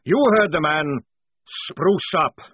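loud parade-ground command; bellowed order. A narrator's reading. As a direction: order the squad to smarten up. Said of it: "he bellowed".

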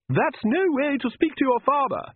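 a hint of a prim Countess telling off; lightly voiced vampire mother. A narrator's reading.

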